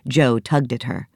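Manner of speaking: plain reading voice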